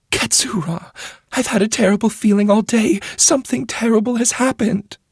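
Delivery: a court lady pouring out her worry; troubled noble lady. A narrator's reading. Wheeler dread.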